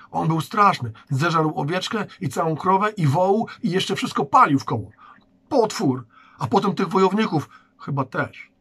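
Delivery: high, loud tumbling words; frightened excited telling. Acted. Oskar tells the guard about the monster, high and excited. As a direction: pour it all out breathlessly.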